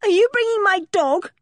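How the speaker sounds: put-on worried girl pleading about her dog; fearful. A narrator's reading. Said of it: "unable to contain her fears".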